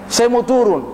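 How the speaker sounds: normal talking voice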